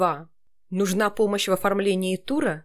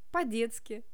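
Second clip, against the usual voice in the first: put-on childlike; 3 semitones higher, 8 dB quieter than usual. Shown as a demonstration. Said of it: "Я могу читать".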